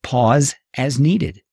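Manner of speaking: slow, deliberate heading; unhurried list heading. Shown as a demonstration. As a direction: read the tip slowly and let it land. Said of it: "the next one".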